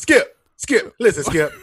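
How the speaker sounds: high, loud squeaky pleading at Skip; mimicking Shannon Sharpe calling out to Skip. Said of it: "Talking like this".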